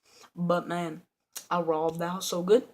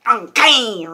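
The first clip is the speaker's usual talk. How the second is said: loud, higher imitated reply; put-on kid voice; 4 semitones higher, 12 dB louder than usual. Kid answering the burglar, put-on loud voice. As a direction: exaggerate the kid's voice, pitched up. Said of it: "wait, that wasn't his voice".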